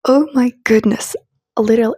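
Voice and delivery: ordinary delivery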